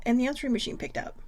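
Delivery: normal talking voice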